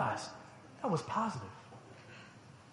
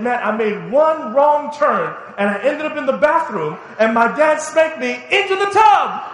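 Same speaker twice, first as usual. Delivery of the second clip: loud, higher, slow, building to the punchline; laughing storyteller; 7 semitones higher, 7 dB louder than usual. Retold or imitated. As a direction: tell it as a funny boast to the boys. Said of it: "and he said".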